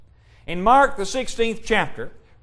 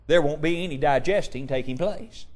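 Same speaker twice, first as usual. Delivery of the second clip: ordinary delivery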